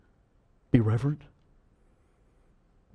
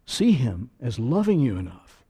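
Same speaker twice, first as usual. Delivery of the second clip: normal talking voice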